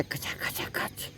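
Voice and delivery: soft unvoiced swishing of a knife through cake; cake-cutting swish noise. Mocking people who cut cakes for likes, soft slicing noise.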